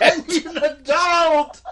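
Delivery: high, loud, slow mock plea; shouting. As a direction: cry out like a scared kid.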